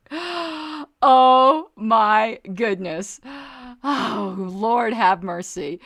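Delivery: loud, drawn-out exclamations, starting high and coming down; recovering from laughter. Real, not acted. Host calming down after a hilarious comment, spent from laughing. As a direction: catch your breath after laughing hard.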